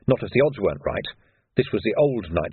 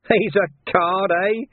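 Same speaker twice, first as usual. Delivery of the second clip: higher, sneering villain voice; sly thug Carcer; 8 semitones higher, 3 dB louder, slower than usual. A narrator's reading. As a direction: voice Carcer as a grinning menace.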